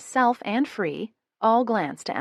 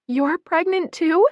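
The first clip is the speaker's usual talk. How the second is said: much higher, louder, slow delighted question; a sister thrilled at news; smiling surprise; 12 semitones higher, 8 dB louder than usual. A narrator's reading. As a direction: ask it grinning, amazed. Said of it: "unable to fight her own smile".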